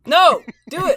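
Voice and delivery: high, loud playful yell; shouting. Real, not acted. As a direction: yell the dare at full volume. Egging friend to do impression.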